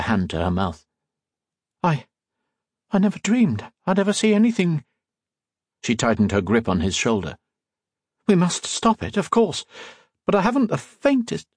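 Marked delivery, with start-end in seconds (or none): 1.8-4.8 put-on high, faltering young heroine; startled Octavia. A narrator's reading. 8.3-11.5 high-pitched, worried heroine; urgent Octavia. A narrator's reading.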